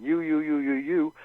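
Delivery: loud, insistent string of accusations; accusatory. Acted. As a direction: hammer each word like a jabbing accusation. Acting out accusatory finger-pointing words.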